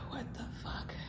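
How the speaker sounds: heavy sigh then whisper; whispered disbelief. Real, not acted. Exasperated whispered aside.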